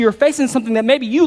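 ordinary talk, nothing marked

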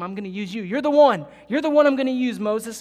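God declaring to Moses, loud and emphatic; commanding God voice. Acted. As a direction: call him out with force.

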